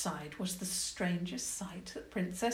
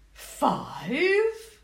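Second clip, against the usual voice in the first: higher, louder rising question; surprised queen; 7 semitones higher, 8 dB louder than usual. Acted. Goblin queen hears about dragons, surprised. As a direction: question it in alarm.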